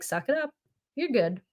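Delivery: 1 dismissive parent. Retold or imitated.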